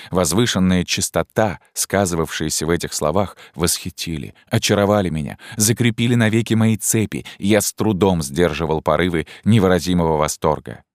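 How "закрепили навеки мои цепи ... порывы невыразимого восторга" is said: ordinary delivery, nothing marked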